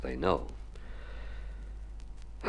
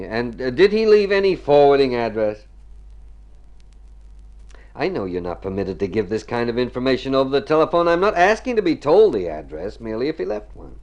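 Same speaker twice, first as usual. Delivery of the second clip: insistent phone voice; 5 semitones higher, 7 dB louder than usual. Acted. Pressing the hotel clerk.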